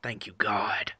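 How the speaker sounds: soft, whispered thanks; hushed relief. Acted. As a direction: whisper it like an answered prayer. Acted reply of relieved scientist.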